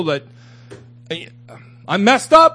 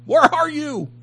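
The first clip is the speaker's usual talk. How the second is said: higher, anguished plea to God; pleading with God; 7 semitones higher than usual. Retold or imitated. Preacher reliving his frustrated prayer to God, higher and anguished. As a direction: cry out to God, frustrated and hurt.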